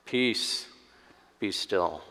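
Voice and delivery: soft, low, calm command. Retold or imitated. Jesus answers their panic, calmly. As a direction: say it quietly, utterly unhurried. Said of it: "he just calmly stands and says".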